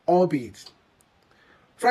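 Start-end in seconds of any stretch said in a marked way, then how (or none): 0.1-0.7 "albeit" as one unit, all-be-it, stressed first; correct albeit. Shown as a demonstration. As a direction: say "albeit" opening on a rounded "all". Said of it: "So we say".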